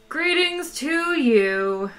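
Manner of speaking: a ghostly voice from a dead planet, drawn out; hollow reedy voice. Acted. Dead planet's recorded voice greeting the ship, hollow and reedy. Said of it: "a voice that was equally hollow, reedy, and insubstantial".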